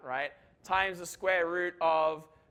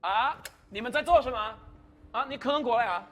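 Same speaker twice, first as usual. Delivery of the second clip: playful call out; 4 semitones higher, 3 dB louder than usual. Real, not acted. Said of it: "I just like yelling".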